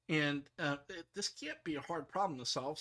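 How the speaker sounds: ordinary talk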